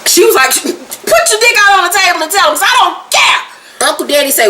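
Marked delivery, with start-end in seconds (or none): 0.6-3.9 high-pitched mock defiance; defiant. Woman daring him to tell all, defiant. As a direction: throw it out like a dare, dragging the end. Acting out her defiant dare.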